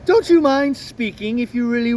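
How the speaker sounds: the cat, politely menacing. Acted. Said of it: "That's my cat voice".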